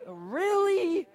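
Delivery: high, stretched incredulous question; drawn-out exasperated "really?"; mock disbelief. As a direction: slide the pitch way up in comic disbelief. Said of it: "so I'm like".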